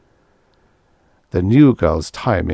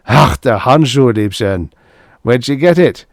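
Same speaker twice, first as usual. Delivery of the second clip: gasping laugh; 4 semitones higher, 6 dB louder than usual. A narrator's reading. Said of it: "Eric gasped".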